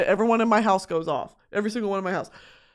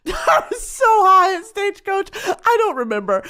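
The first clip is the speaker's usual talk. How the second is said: giggling through the words, higher; laughing; 9 semitones higher, 5 dB louder than usual. Real, not acted. Laughing about being high at a festival. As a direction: laugh it out, pitched up.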